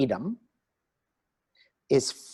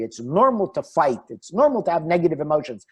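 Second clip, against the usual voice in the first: ordinary talk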